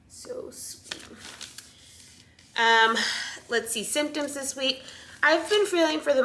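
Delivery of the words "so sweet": barely audible adoring whisper; tender whisper. Real, not acted. Mother gazing at her baby's picture, tender whisper. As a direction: breathe it softly, almost to yourself. Admiring ultrasound picture of baby.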